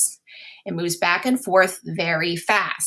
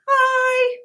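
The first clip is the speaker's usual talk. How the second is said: HIGH squeaked far up in pitch; high-pitched word; 5 dB louder than usual. Shown as a demonstration. Teacher showing sounds too high to hear, very high voice. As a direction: shoot the voice way up on HIGH.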